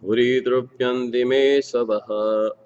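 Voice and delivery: measured, rhythmic Sanskrit verse recitation, each line set apart. Acted. Reader reciting the Bhagavatam verse to listeners, measured and devotional.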